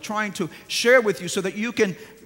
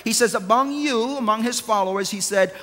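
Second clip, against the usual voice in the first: full-force preaching; 3 semitones higher, 4 dB louder than usual. Acted. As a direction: drive each phrase home to the church.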